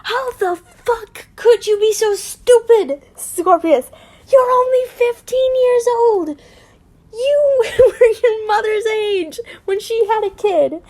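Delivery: high, loud angry scolding; furious outburst. A narrator's reading. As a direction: explode at your son in disbelief. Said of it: "I boiled with anger".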